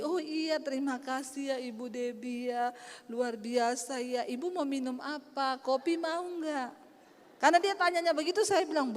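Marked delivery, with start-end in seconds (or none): 0.0-6.7 soft, slow courteous offer; gently drawn-out politeness; sweet hostess. Retold or imitated. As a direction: imitate a gracious woman offering a drink. Woman now sweet, offering coffee.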